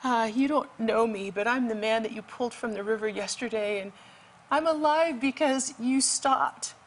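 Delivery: imitated weak, high voice. Rescued man phoning to thank his rescuer, imitated weak voice. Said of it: "this weak voice".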